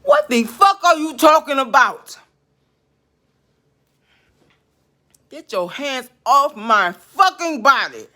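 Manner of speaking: high, loud real yell, then slow and hard; furious shout. Real, not acted. Yelling at the spirit to get its hands off, furious. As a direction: scream it at the spirit.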